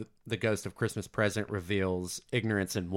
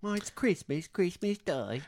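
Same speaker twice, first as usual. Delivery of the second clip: put-on high, light boy's voice; street kid voice; 8 semitones higher, 4 dB quieter than usual. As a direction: answer like an eager young boy. Voicing the street kid's answer.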